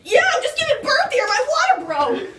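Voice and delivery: high, loud panicked yells with a laugh breaking through; panicked screaming. Acted. Wife whose water broke screams to Karen in hammed-up panic. Snapping at husband mid-labour.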